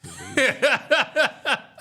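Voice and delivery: sudden high laughing burst; loud high laugh. Real, not acted.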